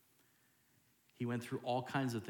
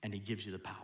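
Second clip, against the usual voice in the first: ordinary talk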